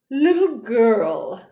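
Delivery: slow, loud, lordly voice; haughty nobleman greeting a child; pompous archduke. Acted. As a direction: talk down to the little girl. Archduke addresses Annabel.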